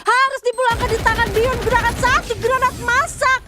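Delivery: fighting game announcer. Real, not acted. Caster hype call on triple kill.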